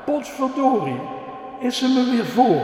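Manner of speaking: put-on a boy outraged his sister beat him again; angry boy. Levi cross that his sister beat him, high and loud. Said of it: "riep Levi boos".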